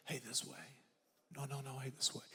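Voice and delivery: put-on soft coaxing call to a toddler; barely above a murmur, guiding; gentle quiet nudge. Grandpa softly steering his granddaughter, quiet and gentle. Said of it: "all I have to do is say".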